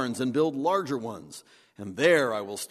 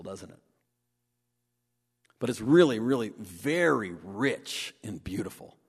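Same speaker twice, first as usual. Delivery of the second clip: usual speaking voice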